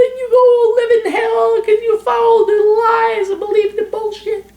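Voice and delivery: high, gushing parody of a flattering guru; gushing mock Oprah fan. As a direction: fawn over the client in falsetto. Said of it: "Touchy-feely consulting".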